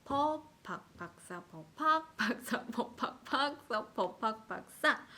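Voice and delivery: 퍼 팍 박사 chanted with one syllable popping out each round; absolute-pitch game stress. Shown as a demonstration. Teacher demonstrates the TV game, shifting accent through 퍼 팍 박사. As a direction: move the accent along 퍼, 팍, 박사 playfully. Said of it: "우리 그 절대음감 게임 있죠".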